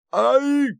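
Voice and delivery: very high-pitched yell of "ow"; sudden high cry of pain; pained yelp. A narrator's reading. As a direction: yelp sharply, pitch leaping right up. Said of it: "завопил Манфред".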